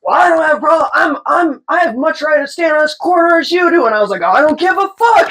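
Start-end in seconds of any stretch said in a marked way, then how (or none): 0.0-3.9 indignant protest. Retold or imitated. Man arguing back, acted out. 4.2-5.3 high, heated outburst; re-enacted unhinged yell; going crazy. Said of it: "So I'm just going crazy".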